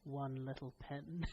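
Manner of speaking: sly quiet taunt. Retold or imitated. Uncle nudges the anxious aunt on the plane, soft and slow. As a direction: murmur it slowly to needle her. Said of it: "he would nudge her and say".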